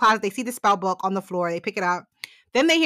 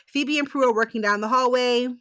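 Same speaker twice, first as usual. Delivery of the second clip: ordinary talk, nothing marked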